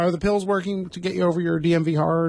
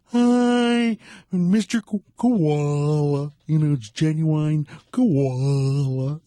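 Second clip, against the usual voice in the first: hammed-up character voice, stretching koala out slowly; impression of a character; much slower than usual. Host imitating a comedy character who walked around, hammed-up drawn-out voice. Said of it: "the guy who walked around going".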